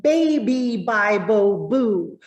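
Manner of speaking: put-on punchy over-articulated "Baby, Bible, boo"; consonant drill. Shown as a demonstration. Teacher demonstrating a diction warm-up to learners, exaggerated articulation. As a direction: pop every b with the lips. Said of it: "maybe I say".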